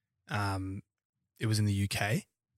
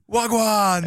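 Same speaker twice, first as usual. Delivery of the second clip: high, loud mock Wagwan; hammed-up London street greeting; mock slang greeting; 12 semitones higher, 9 dB louder than usual. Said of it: "what about "Wagwan"?".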